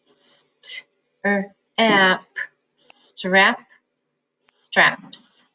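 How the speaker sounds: segmenting the sounds slowly; building the word sound by sound. Shown as a demonstration. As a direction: sound it out piece by piece. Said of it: "let's spell it away".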